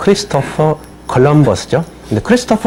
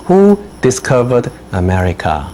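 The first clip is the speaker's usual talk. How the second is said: slow dictation read; much slower than usual. Shown as a demonstration. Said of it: "teacher reads a dictation question".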